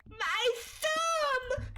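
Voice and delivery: put-on very high, drawn-out child's wail; pained wail. Hurt kid to parents, wailing in pain. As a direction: squeal it out in mock agony.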